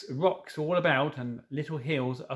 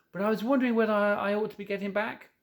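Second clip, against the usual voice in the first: lightly voiced young girl, higher; a hint of an eager little girl, pitched up; young Lucy; 6 semitones higher, much faster than usual. A narrator's reading.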